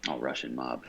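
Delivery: ordinary delivery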